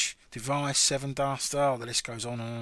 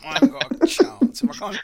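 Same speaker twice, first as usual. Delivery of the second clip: exasperated; 9 semitones higher, 9 dB louder than usual. Real, not acted. Despairing over the warm-up question.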